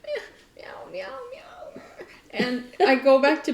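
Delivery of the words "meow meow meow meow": very high, soft little meow; small squeaky cat voice. Acted. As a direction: imitate a cat with tiny high mews. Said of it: "and he's still".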